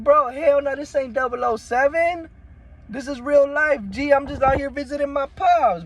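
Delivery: high, loud protesting voice; teen insisting he's just visiting dad, high and loud; imitating his younger self. His own retort, acted.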